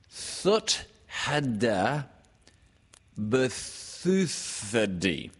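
each garbled word drawn out and set apart; halting sounding-out. A narrator's reading. Narrator reads out what Owl wrote, halting garbled syllables. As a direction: stumble slowly through the misspelt syllables. Sounding out Owl's misspelt writing.

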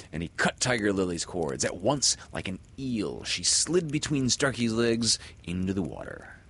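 usual speaking voice

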